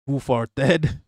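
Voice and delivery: "farted" split as far-TED, full final vowel; puzzled reading of "farted" as far-TED; misread name. Retold or imitated. Streamer recalling misreading a viewer's username, "farted" said as far-TED. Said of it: "I'm like, "What?".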